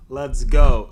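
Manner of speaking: loud punchy "let's go"; hype shout. Real, not acted. Crypto host hyping his audience, loud excited call. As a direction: fire up the viewers.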